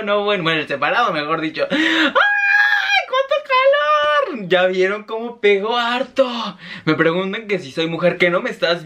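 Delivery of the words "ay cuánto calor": high, slow, drawn-out cry; excited exclamation. Real, not acted. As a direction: wail it out, fanning herself. Exclaiming at the heat.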